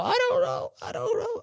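mock tiny voice answering his own question; silly self-answer.

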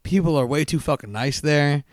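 ordinary delivery

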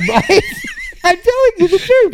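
high, loud insistence through laughter; real laughing protest. Real, not acted. Storyteller defending his deaf Fred tale, laughing. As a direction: insist on it while cracking up.

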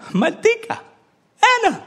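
high-pitched imitation of dad calling her. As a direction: act dad suddenly calling out to mom, high. Dad briefly recognizes mom, calls her name.

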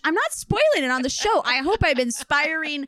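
high, loud and fast refusal; excited protest. Real, not acted. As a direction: blurt it out gleefully, racing.

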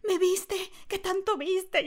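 high-pitched, anxious retold questions; alarmed questioning. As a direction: imitate someone mortified at being seen. Acts out Omar's alarmed questioning.

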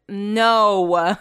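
loud, drawn-out exasperated "no"; imitated exasperation. [name] answering the neighbor's silly question, mock exasperated. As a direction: mimic a fed-up refusal.

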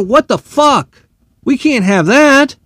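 normal talking voice

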